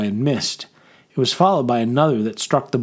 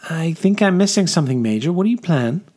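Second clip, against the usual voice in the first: higher-pitched lady's voice; puzzled noblewoman; 6 semitones higher, faster than usual. Acted. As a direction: question the officer's plan, pitched up. Puzzled lady questions the Major.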